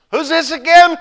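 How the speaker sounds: high, loud suspicious shout; calling up. Acted. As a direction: yell up, doubting. Hanging man calling up.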